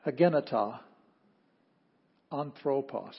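Greek read out word by word; deliberate, isolated words of a Greek reading; reading aloud. Shown as a demonstration. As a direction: leave a long pause after every word.

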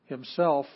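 himself hit loud; stress on himself. Shown as a demonstration. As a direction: punch himself.

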